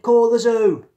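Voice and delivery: loud, high shout through the door. A narrator's reading. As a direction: yell it one last time. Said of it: "opened it and shouted".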